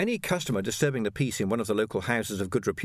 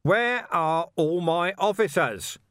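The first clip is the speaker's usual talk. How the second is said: loud, slow, raised yell; 7 semitones higher, 4 dB louder, slower than usual. A narrator's reading. Vimes yelling for his missing officers. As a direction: bellow it across the room. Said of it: "Vimes yelled".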